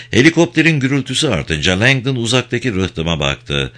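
ordinary delivery, nothing marked

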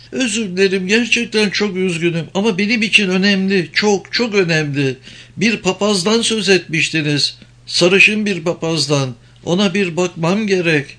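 high, urgent pleading woman. Acted. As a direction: beg him anxiously, voice up high. (yalvaran, tiz kadın sesi)

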